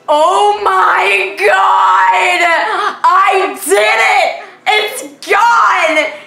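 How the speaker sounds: high, loud, slow cry-laughing shout; elated shout. Retold or imitated. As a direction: shriek with relief.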